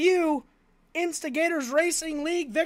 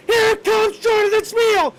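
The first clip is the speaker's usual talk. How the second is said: shouting; 6 semitones higher, 6 dB louder than usual. Real, not acted. Call repeated louder.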